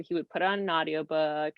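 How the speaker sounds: ordinary talk, nothing marked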